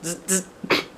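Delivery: high playful zzz buzz; sparky sound. A narrator's reading. Junie B. sparks Jim back with a high buzzing noise. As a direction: zap right back. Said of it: "I sparked him right back".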